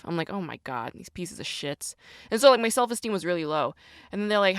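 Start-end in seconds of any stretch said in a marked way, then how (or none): 0.3-1.9 low, soft and slowing grumble; retold under-the-breath exasperation; exasperated aside. Worker reacting privately to a bad assessment score, exasperated.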